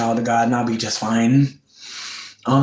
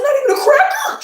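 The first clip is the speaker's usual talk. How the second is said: very high, loud, shrieked disbelief; shocked disbelief; 4 dB louder than usual. Retold or imitated. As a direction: shriek it like you can't believe it. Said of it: "I was like".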